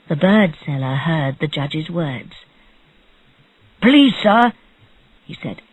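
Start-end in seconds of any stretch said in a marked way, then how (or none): 3.8-4.5 raised, louder appeal; pleading. A narrator's reading. Bird seller begs the judge to listen, pleading. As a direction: interrupt with an urgent plea.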